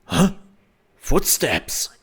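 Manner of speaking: lightly voiced suspicious guard; puzzled guard. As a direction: ask it like a confused soldier. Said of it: "the guard is going like".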